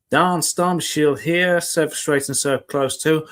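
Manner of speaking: a brief put-on American accent, slow; slipped into American. Reading his storm detector, lapsing into a slow American accent. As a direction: drift into an American accent without meaning to. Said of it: "I don't know why I went American then".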